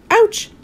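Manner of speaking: high pained gasp; Mister Greedy kicked in the tummy, gasping; gasped ouch. A narrator's reading. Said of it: "gasped Mister Greedy".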